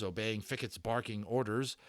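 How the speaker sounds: usual speaking voice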